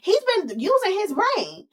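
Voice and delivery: put-on high-pitched, dramatic girly voice; hammed-up complaint way up in the head. Y G complaining to her friend about McDreamy, high and dramatic. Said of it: "Y G is like".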